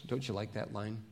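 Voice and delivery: normal talking voice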